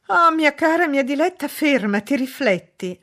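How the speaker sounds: high, loud plea that eases down; imploring. A narrator's reading. Mother begging her daughter to stop, imploring. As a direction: beg urgently, starting high. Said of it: "la implorò la madre".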